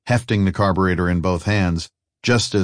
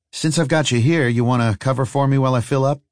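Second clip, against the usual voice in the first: higher, casual character voice; lightly voiced older traveller; 6 semitones higher than usual. Older man asking his friend for cover, lightly voiced and higher. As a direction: lift the pitch for a casual favor.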